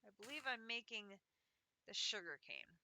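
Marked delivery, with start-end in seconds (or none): none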